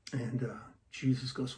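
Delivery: ordinary talk, nothing marked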